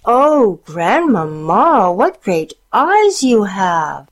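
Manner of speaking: slow loud cry. A narrator's reading. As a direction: call out in alarm. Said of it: "the girl cried out".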